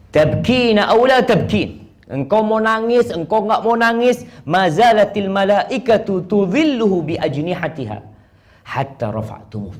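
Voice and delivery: voiced Prophet.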